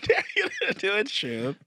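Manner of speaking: high, slow teasing echo; teasing friend echoing the dare back slowly; mock echo. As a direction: drag it out, mocking her words. Echoing her request mockingly.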